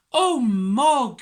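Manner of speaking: loud, high, drawn-out oh; dismayed exclamation. A narrator's reading. Debbie reacts to Mog's escape, loud high dismay. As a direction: wail it in exasperation at the cat.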